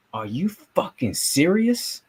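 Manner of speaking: slow stunned outburst of disbelief; drawn-out are you kidding me. Retold or imitated. Reporter in the press box reacting to the loss, stunned disbelief. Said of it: "I was like".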